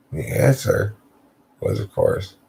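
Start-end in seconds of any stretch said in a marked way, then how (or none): none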